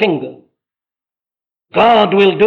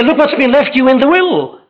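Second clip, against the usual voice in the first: preacher's reply; 7 semitones higher, 3 dB louder than usual. Retold or imitated. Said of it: "I say".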